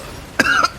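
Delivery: real choking cough; hacking cough on candy; coughing fit. Real, not acted. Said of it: "I'm choking on M and M's".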